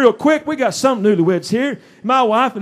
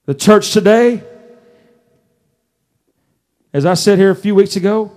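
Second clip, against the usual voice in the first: louder, emphatic pulpit delivery; preaching at full force; 6 dB louder than usual. Real, not acted. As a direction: hammer each phrase to the congregation.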